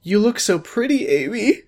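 high, teary mom voice; mom about to cry. Acted. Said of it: "my mom said, about to cry".

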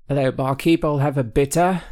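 put-on a deliberate English pub accent, ordering from the barkeep. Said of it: "That sounds better".

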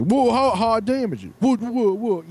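high-pitched imitation of a sputtering objection; indignant mock protest. Worker balks at being told he risks damaging parts, indignant.

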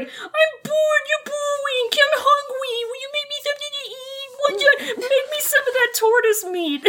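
whiny bored brat. Retold or imitated. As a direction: whine like a bored kid nagging for food. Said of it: "He's like".

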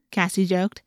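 ordinary delivery, nothing marked

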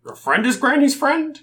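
high, loud disbelieving question; incredulous. Acted. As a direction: squeak it out in disbelief. Fish guy incredulous.

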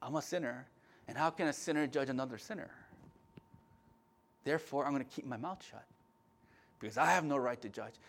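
a hint of a reluctant objector, subdued; imagined objector. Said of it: "You'll say".

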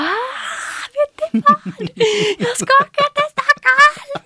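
very high shrieking with laughter in it; excited screaming. Retold or imitated. Calling a friend with the Stockholm news, screaming. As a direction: scream the big news hysterically. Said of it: "Så jag skriker och lägger på".

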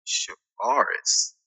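"chivalrous" read slowly as shavaris; sounding out words. Retold or imitated. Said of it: "you said something about".

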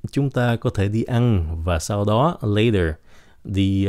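normal talking voice

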